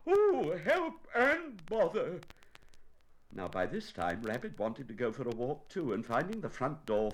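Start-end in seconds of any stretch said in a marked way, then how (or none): 0.1-2.2 high, loud, slow wail; hammed-up bear calling for help; distressed bear. Pooh stuck and upset.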